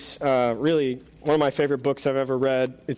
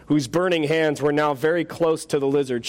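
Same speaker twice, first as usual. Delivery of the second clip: ordinary talk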